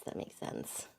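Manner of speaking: breathy whisper of agreement. Real, not acted. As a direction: say it under your breath. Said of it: "repeated unvoiced".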